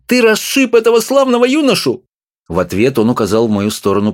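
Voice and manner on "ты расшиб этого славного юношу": much higher, outraged yell at her husband; shrill scolding cry. A narrator's reading. Wife crying out at her husband, outraged. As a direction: screech it at him in outrage. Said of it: "закричала она".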